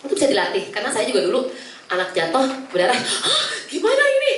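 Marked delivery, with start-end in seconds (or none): 2.9-4.3 panik menirukan guru, tinggi dan lambat. Retold or imitated. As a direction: tirukan guru yang bingung melihat darah. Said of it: "gurunya panik". (guru panik, nada tinggi dan lambat)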